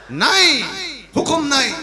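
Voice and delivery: high, emphatic cry of denial; emphatic preaching. Real, not acted. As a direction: call it out as a firm verdict. Preacher hammers the answer home.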